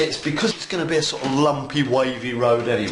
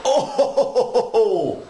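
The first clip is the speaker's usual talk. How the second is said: laughing; 10 semitones higher, 3 dB louder than usual. Real, not acted. Delighted at texture.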